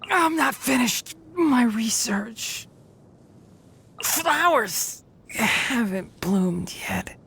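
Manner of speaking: slow, strained last words; dying, out of strength. Acted. Game character falling in battle with work unfinished, dying gasp. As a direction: struggle the words out with failing breath.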